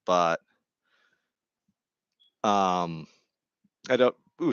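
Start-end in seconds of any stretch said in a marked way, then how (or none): 3.9-4.5 hiccup cutting into the words. Real, not acted. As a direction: voice jumps on a hiccup. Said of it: "excuse me, I get the hiccups".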